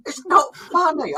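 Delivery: high, slow mock protest in Zimbabwean accent; imitated Zimbabwean accent. Zimbabwean workmate telling them off, imitated accent. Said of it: "taking the piss out of my accent".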